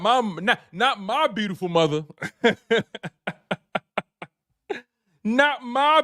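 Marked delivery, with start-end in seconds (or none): 2.1-4.2 a long genuine laugh; laughing at the chat's mom joke; real laugh. Real, not acted. Laughing at chat comment.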